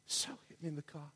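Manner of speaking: overcome, hushed voice. Real, not acted. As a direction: let the emotion choke it down to a hush. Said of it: "And I don't talk like this".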